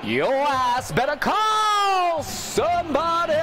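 slow, high, drawn-out hype shout; wrestler catchphrase. Retold or imitated. Gamer repeating the in-game wrestler's catchphrase, hyped and slow. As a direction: belt it like a ring entrance. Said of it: "we even had him saying it".

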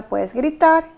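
loud and high, laying down the law. Shown as a demonstration. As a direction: raise your voice firmly over a screaming child. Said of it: "usted simplemente diga". (voz alta y firme, poniendo límites)

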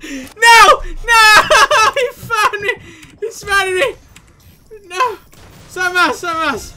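high, loud wailing laughter; laughing dismay. Real, not acted. Hidden player just found by hunters, laughing dismay. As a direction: wail in protest while cracking up.

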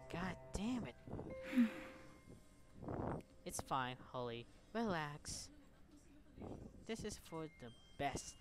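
ordinary talk

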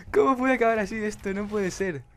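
high, loud incredulous cry; stunned disbelief. Real, not acted. Gamer crying out in disbelief at the ending. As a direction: protest in disbelief.

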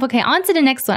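usual speaking voice

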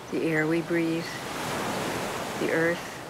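normal talking voice

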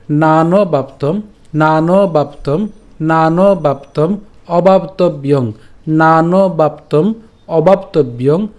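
"নানবাপ্তম" drawn out, with a dental n. Shown as a demonstration. Teacher drilling the dental n, slow recitation. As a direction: slowly repeat "নানবাপ্তম", tongue on teeth for n. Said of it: "এটা দন্তান্ন হবে".

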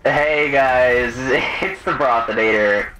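normal talking voice

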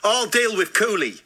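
put-on botched South African accent. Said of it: "No, that's Aussie".

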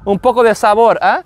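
Spanish accent with a little flair; lightly flirty Spanish accent; sexy Spanish flair. Shown as a demonstration. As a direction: add Spanish flavor, playfully. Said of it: "sexy flair to it".

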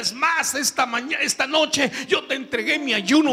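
tearful plea. Retold or imitated. Preacher reliving begging God in the dark tunnel, rising tearful plea. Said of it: "empecé a llorar y a decirle".

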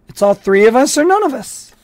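loud, firm refusal; defiant refusal. A narrator's reading. Score refusing the sergeant, loud and defiant. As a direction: plant your feet and refuse out loud. Score insists on his friends.

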